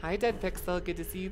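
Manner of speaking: ordinary talk, nothing marked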